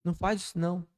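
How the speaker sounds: quiet, gentle warning; soft inner voice. Retold or imitated. Preacher voicing the inner voice, softly warning. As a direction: hush it like a conscience. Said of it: "aquela voz do interior que fala".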